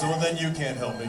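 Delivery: lightly voiced stoned guy, low; self-important stoner. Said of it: "he thinks he's saving the world".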